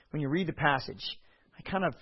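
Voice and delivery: ordinary talk, nothing marked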